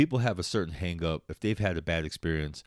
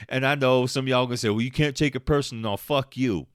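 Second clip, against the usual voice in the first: heated rant; 5 semitones higher, 6 dB louder than usual. Real, not acted.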